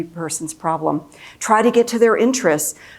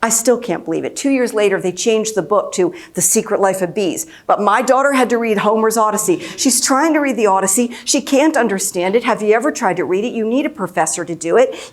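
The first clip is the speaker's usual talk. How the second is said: normal talking voice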